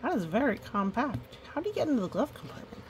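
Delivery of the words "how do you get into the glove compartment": usual speaking voice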